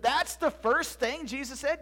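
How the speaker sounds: slow, high, rising disbelief; incredulous question. Acted. Boy doubting Zeb's account, incredulous. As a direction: question it slowly, ending on a rise.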